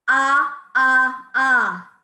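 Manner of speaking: three loud punched ahs from the belly; loud staccato ah. Shown as a demonstration. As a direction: pump each ah out loud and equal. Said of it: "And number five".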